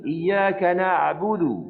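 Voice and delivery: slow chant, ain of na'budu in the throat; recitation demo. Shown as a demonstration. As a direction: squeeze the throat lightly on the ain. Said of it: "Tengok pula huruf ain".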